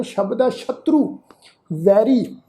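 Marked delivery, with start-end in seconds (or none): none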